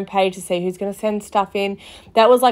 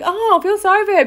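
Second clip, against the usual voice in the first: mock pity; 11 semitones higher, 4 dB louder, faster than usual. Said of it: "people would be like".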